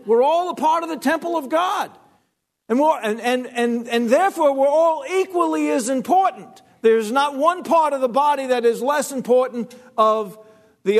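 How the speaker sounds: high, ringing preacher's delivery; preaching at full force. Acted. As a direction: preach it out to the whole room. Preaching to congregation.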